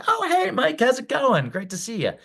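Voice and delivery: warm friendly reply. Shown as a demonstration. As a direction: answer back delighted to see him. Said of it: "you hear them respond".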